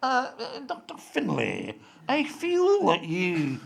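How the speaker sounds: slow, halting mock old-actor delivery with high stammers; Andrew Cruikshank, forgetting lines. Mimicking actor who can't remember his lines, slow and faltering. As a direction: stall on the ums, then draw the words out grandly. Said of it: "could never remember his lines, so he fiddled about".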